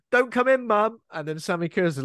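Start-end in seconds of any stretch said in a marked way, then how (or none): 0.1-1.0 high, loud call to mum; mock annoying whine. Boy telling his mum to stay out, high mock protest. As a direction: call out high and pestered, mocking him. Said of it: "annoying thing where he's, like, going".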